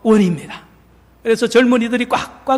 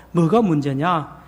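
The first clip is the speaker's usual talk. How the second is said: usual speaking voice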